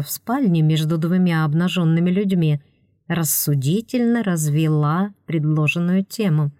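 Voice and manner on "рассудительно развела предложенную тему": ordinary delivery, nothing marked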